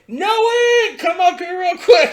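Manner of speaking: loud, high call across the club. Retold or imitated. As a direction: shout for Noe to come up. Calling friend up to booth.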